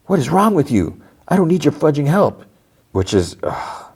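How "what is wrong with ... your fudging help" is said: high-pitched imitated indignation; indignant outburst. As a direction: act out her outrage, pitch way up. Retold angry outburst at Joel.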